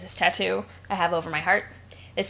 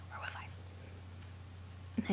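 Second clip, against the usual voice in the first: whisper; 17 dB quieter, much slower than usual. Real, not acted. Whispered aside in reading.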